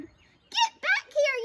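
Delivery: high, loud, drawn-out order; yelling. A narrator's reading. Pip recalls fleeing dogs, urgent yell. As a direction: yell after the scattering crowd. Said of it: "yelled Pip".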